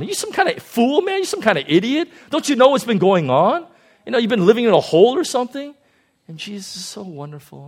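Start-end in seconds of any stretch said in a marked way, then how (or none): 0.0-5.7 higher, louder scornful scolding; mock smackdown. Disciples scolding Jesus in a loud mocking smackdown. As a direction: scold him like a fool. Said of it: "They give him a smackdown".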